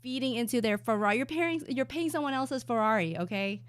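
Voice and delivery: loud mock guru rant; imitating Matt. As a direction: rant at chat like a trader. Ranting as Matt to chat.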